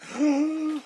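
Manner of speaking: sharp, very high theatrical gasp; mock shocked gasp. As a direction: gasp in fake horror. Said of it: "And everybody's like".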